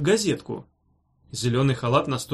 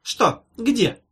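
high, feigned bafflement; short startled questions; surprised; 8 semitones higher, 3 dB louder than usual. A narrator's reading. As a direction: act innocently astonished. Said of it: "удивилась светило медицины".

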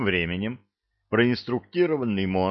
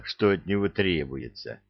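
plain reading voice

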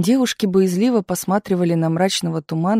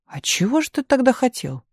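amazed, much higher-pitched question; astonished question; 8 semitones higher than usual. A narrator's reading. Misha questioning Tumanov about his wishes, astonished. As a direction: let the pitch leap in amazement. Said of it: "изумился Миша".

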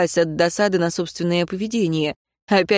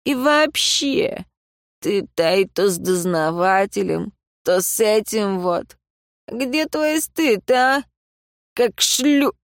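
put-on town drunk; 3 semitones higher, slower than usual. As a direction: drag it out like a leering drunk. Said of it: "пьяный голос Зака".

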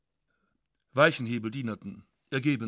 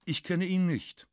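dignified; 6 semitones higher than usual. Retold or imitated. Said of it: "Er sagte würdig".

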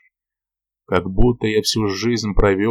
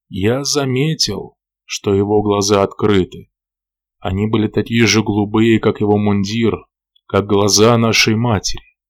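usual speaking voice

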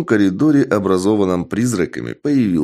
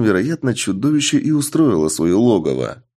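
ordinary delivery

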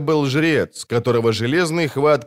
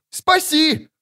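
wailing. A narrator's reading. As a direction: howl for help. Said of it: "вопил Лютик".

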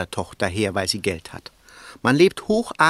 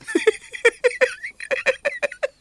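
high, scornful burst of laughter; gleeful sneering cackle; mocking laugh. Real, not acted. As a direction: laugh off the joke with derision. Laughs at his own mockery.

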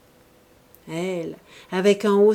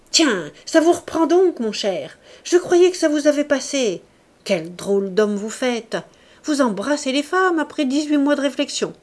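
loud, higher-pitched scorn; scornful exclamation; 6 semitones higher, 6 dB louder than usual. A narrator's reading. Woman mocking the man who grabs at her, scornful exclamation. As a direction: cry it out with contempt. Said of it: "toute méprisante, en s'écriant".

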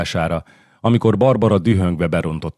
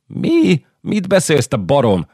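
shrill, much higher shocked cry; shocked outburst; 8 semitones higher, 3 dB louder, slower than usual. Acted. Queen cries out in disbelief at the count's claim. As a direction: snap at him in disbelief.

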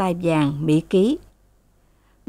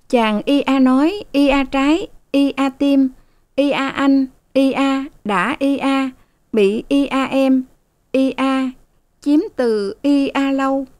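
slow, higher, blurred reading aloud; lightly mumbled reading; 7 semitones higher than usual. A narrator's reading. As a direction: read the novel haltingly, swallowing words. Maid reads novel aloud, mumbling. (đọc tiểu thuyết ê a, lúng búng)

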